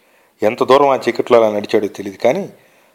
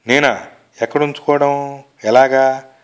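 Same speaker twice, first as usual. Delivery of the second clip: ordinary delivery, nothing marked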